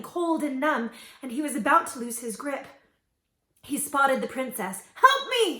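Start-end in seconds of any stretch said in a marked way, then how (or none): 4.9-5.6 high, loud desperate cry; crying for help. A narrator's reading. Drowning boy calling to the princess. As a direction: scream for help. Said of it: "he cried".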